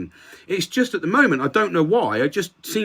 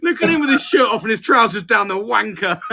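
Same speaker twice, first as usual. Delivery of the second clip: laughing mockery; 6 semitones higher than usual. Real, not acted. Mocking partygoer, laughing.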